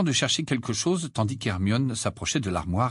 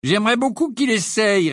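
higher, louder sneer; 11 semitones higher, 5 dB louder than usual. A narrator's reading. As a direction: scoff at the idea. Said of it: "ricana George".

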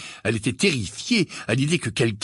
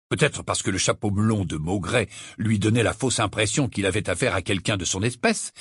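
plain reading voice

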